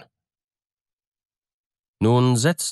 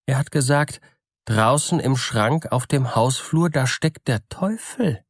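higher-pitched peasant revealing a secret; Bürle, sly soothsayer; 5 semitones higher than usual. Acted. Bürle reveals the devil to the miller, high character voice. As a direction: build to the revelation in a raised pitch.

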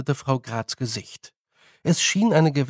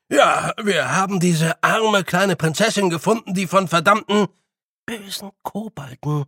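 higher, quicker little fairy pressing on; fluent Feegle; 6 semitones higher, 3 dB louder than usual. A narrator's reading. Billy telling the old woman the story, fluent higher Feegle. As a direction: say it straight out now.

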